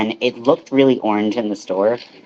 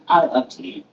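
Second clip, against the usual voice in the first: a light Anchorman-style declaration of I love tea; deadpan Anchorman line, lightly done; Anchorman moment; 5 semitones higher, 3 dB quieter than usual. Pretend. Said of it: "Definitely have a little Anchorman moment there".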